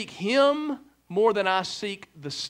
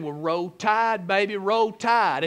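slow Southern drawl, lightly put on; good old boy drawl; slower than usual. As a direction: stretch "Roll Tide" slowly like a Southern girl. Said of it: "you really got the good old boy talk".

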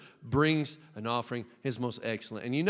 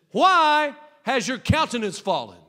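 preached question; 8 semitones higher, 8 dB louder than usual. Acted. Preached verse.

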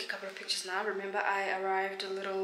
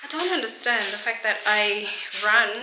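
out of breath; 9 dB louder than usual. Real, not acted. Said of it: "I'm always out of breath".

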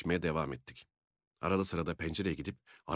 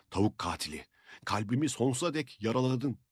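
angry; 6 semitones higher than usual. A narrator's reading. Sopayla vururken bağırıyor.

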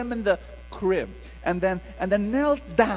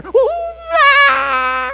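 high, loud imitated infant wail; loud baby wail; 10 dB louder than usual. Imitating baby crying at night.

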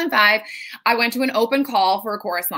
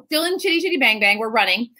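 ordinary talk

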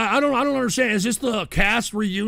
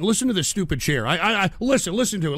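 ordinary talk, nothing marked

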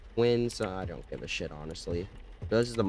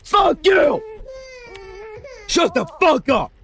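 raging scream; 11 dB louder, slower than usual. Real, not acted. Gamer screaming at the misbehaving baby, furious. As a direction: scream it, totally losing it.

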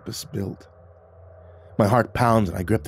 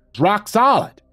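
excited high voice; 13 semitones higher, 7 dB louder than usual. Acted. Zeke reports the tree.

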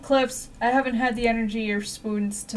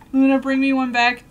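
ordinary talk

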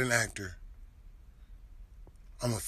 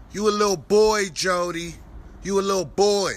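loud mocking yell; taunting shout; 3 semitones higher, 7 dB louder than usual. Real, not acted. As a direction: bellow it to belittle him. Taunting Jody loudly.

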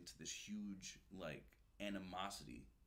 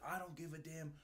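angry scolding; 8 semitones higher than usual. Retold or imitated. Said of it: "He's like".

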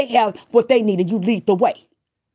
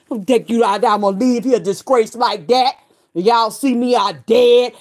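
ordinary delivery

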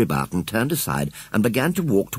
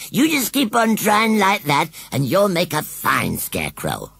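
higher, slow rustic coaxing; encouraging; 11 semitones higher, slower than usual. A narrator's reading. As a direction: cheer her on like a coach. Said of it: "shouted Gummidge encouragingly".

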